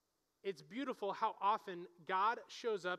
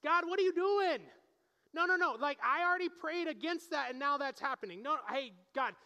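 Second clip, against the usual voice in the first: exasperated complaint to God; 4 semitones higher than usual. Pretend. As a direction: complain to God like a frustrated child. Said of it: "Elijah reminds me of me".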